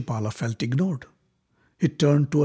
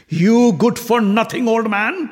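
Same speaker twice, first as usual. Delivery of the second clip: higher, louder furious yell; 11 semitones higher, 7 dB louder than usual. A narrator's reading. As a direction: yell the insult at the old man. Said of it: "Shishupala yelled at Bhishma".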